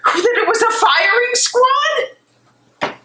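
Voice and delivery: crying, furious reproach to her husband; tearful anger. Acted. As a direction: break into sobbing rage at the lie. Discovers he lied about execution.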